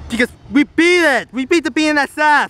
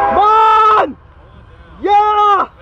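high, loud cheering shout; excited yelling; 6 semitones higher, 5 dB louder, much slower than usual. Real, not acted. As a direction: yell to urge it on. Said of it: "I was yelling because I was so excited".

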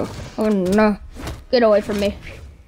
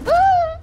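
high-pitched short scream; startled yelp. Real, not acted. Player hit mid-fight in a game, high startled yelp. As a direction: yelp in sudden alarm.

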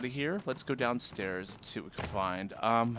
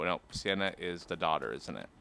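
normal talking voice

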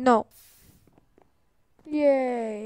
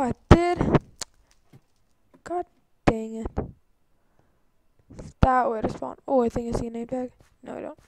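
ordinary talk, nothing marked

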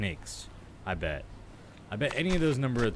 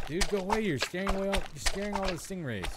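exasperated shooing; 7 semitones higher than usual. Real, not acted. Gamer telling the shark to leave, exasperated. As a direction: shoo the pest off, fed up.

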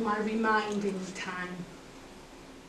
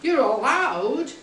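ALLOWED drawn out slowly, loud and high; stress on ALLOWED; 4 semitones higher, 8 dB louder, much slower than usual. Shown as a demonstration. Speaker to audience on being allowed out, ALLOWED stressed slowly. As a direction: stretch and lift the word "allowed".

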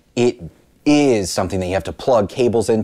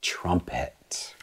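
whisper; 3 semitones lower, 9 dB quieter than usual. Real, not acted. Whispered answer.

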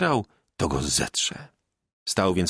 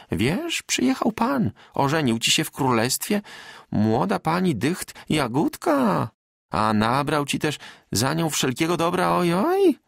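higher, excited village woman; peasant wife Magda, eager; 7 semitones higher than usual. A narrator's reading. Magda telling Bartek news, eager and high.

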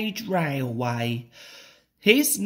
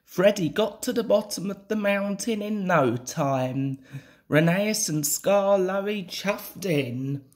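plain reading voice

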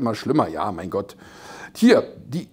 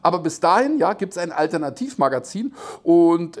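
usual speaking voice

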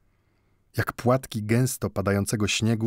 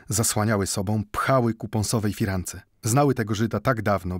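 usual speaking voice

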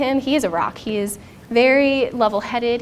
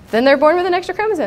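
louder, higher and fast, matter-of-fact; firm quick reply; 7 semitones higher, 7 dB louder, faster than usual. Retold or imitated. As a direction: shut down the worry quickly and loudly. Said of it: "He said".

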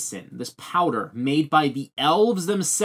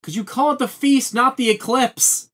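high, loud objection; heated protest; 10 semitones higher, 5 dB louder than usual. Real, not acted. Correcting a co-host's name for the arc, heated protest. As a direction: protest it with raised pitch and volume.

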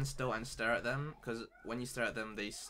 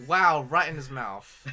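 surprised; 4 semitones higher, 14 dB louder, slower than usual. Real, not acted. Reacting to game event.

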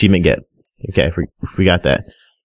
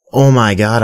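sharp gasp then excited; 5 semitones higher than usual. Real, not acted. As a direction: gasp as an idea hits. Sudden idea.

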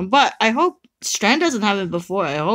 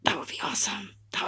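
whisper; 13 dB quieter, much faster than usual. Real, not acted. Hushed hope during summon.

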